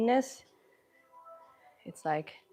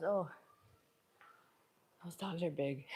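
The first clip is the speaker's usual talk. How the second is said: ordinary delivery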